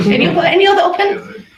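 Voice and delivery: laughing question to the room. Real, not acted. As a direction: toss it out with a laugh.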